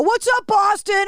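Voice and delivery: high mock rock-show shout; stage greeting. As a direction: imitate a band greeting the crowd. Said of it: "go up on stage in Worcester and go".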